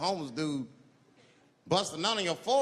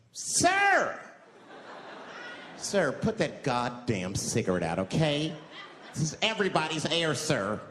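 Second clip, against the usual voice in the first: uptight passenger scolding; slower than usual. Retold or imitated. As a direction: scold the smoker like a prissy passenger.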